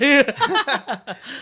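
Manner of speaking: big loud laugh; loud burst of laughter. Real, not acted. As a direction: crack up laughing at the joke. Laughs at own quote.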